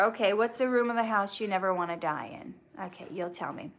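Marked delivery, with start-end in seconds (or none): none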